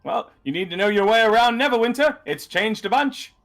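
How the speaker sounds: high-pitched hard sell from a street hawker; insistent map seller, high throughout; overly emphatic vendor. Acted. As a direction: climb high and push the sale. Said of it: "overly, uh, emphatic".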